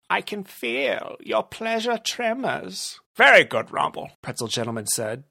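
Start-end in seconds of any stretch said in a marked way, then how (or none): 0.1-3.0 higher, timid henchman; lieutenant obeys the boss, higher and meek. Acted. Rumble speaks. 3.2-4.1 brush-off, loud then dropping; dismissive. Acted.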